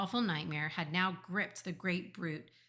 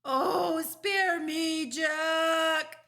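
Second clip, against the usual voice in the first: high, loud wail for mercy; pleading yell; 11 semitones higher, 11 dB louder, much slower than usual. A narrator's reading. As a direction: yell it like a terrified giant. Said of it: "he yelled".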